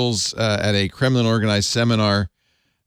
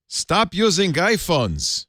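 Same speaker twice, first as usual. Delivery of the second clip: bad Russian accent; 7 semitones higher, much slower than usual. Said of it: "bad Russian accent".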